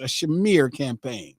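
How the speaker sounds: slow, low "smear" said as shuh-MEER; mock pronunciation. Shown as a demonstration. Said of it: "That's how it's pronounced".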